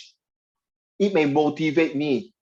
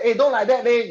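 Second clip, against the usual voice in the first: high-pitched Singlish complaint, a little raised; acted Singlish protest; 8 semitones higher than usual. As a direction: mimic a Singaporean protesting to a friend. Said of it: "If the two Amor talk like that".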